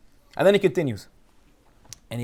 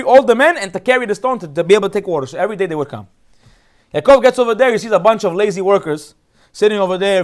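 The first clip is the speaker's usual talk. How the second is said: normal talking voice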